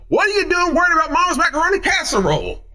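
high, fast scolding question; hammed-up incredulous rebuke; mock incredulous. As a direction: pitch it way up in exasperated disbelief. Scolds the excuse-maker in mock disbelief.

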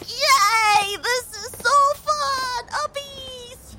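squealed, very high and slow; gleeful kid. Acted. [name] carried off by Buddy, squealing high with childish glee.

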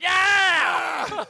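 loud, high mock-dog yell; imitated dog howl. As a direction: let out a long doggy scream. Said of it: "Dog would be like".

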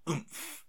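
forceful push on "oomph"; added oomph. Shown as a demonstration. Friend showing how the security guard said it, "oomph" pushed out hard. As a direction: punch out "oomph" on a strained burst of air. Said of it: "with a bit more".

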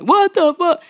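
sharp high "what the fuck"; defensive outburst. Retold or imitated. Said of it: "that was my defense".

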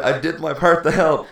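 high, loud self-justifying call; mock proud shout. Bystander flees the big fight, loud mock-proud shout. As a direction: shout it as if running away.

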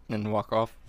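ordinary talk

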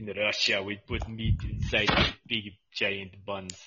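slow mock Russian accent; drawn-out hammy Russian accent; heavy Russian accent menace. Russian joke voice.

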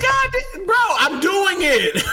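high, loud, slow exasperated shout; annoyed yell. Retold or imitated. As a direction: yell back at the attendant. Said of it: "Why are you yelling at me".

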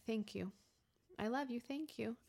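very soft, tender thanks; gentle acceptance. Acted. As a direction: answer the inner voice quietly and kindly. Soothing reply to shame voice.

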